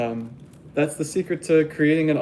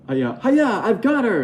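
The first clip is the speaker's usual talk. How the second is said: lightly voiced goofy henchman, high and laughing; dumb sneaky character; 8 semitones higher than usual. Dumb henchman sneaking up on her, high giddy cackle. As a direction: pop up behind her, gleeful and silly. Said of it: "he sneaks up behind her and goes".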